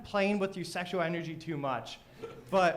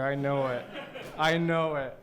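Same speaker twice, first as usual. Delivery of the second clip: usual speaking voice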